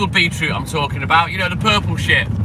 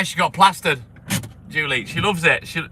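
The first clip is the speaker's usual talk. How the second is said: usual speaking voice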